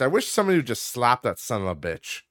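ordinary talk

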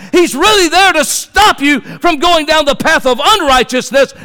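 high, loud preaching shout, slowing on the hallelujah; full-force preaching. Acted. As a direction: lift the church with a ringing cry.

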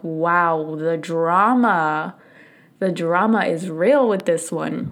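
slow, drawn-out sarcastic "the drama"; laughing at her own meltdown, wry; wry self-mockery. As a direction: roll your eyes at yourself. Mocking her own melodrama.

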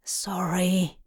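soft unvoiced whisper. A narrator's reading. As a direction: whisper an apology. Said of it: "Crowley whispered".

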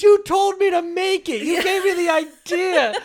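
high, loud whiny protest; friend's whiny voice. Retold or imitated. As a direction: whine it back at him. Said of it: "He goes".

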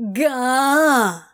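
loud exasperated gah. Acted. Crowley exasperated noise.

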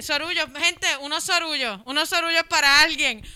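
loud mock announcement; retold shout. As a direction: call out over the crowd, fed up. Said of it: "yo terminé mi set gritando".

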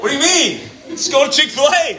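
high, hammed-up exasperated outburst; mock exasperation. Acting exasperated friend in lunch story.

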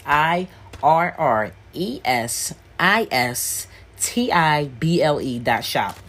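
slow, emphatic letter-by-letter spelling. Real, not acted. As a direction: spell each letter out slowly for listeners. Spelling out the hard word.

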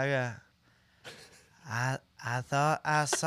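low, slow, soft rapper voice; badly faked laid-back drawl.

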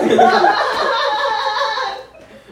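high, loud, strange laugh; a cackle that makes friends ask; odd high laugh. Real, not acted. Friend laughs oddly while spinning, high and loud. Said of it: "Why do you laugh like that?".

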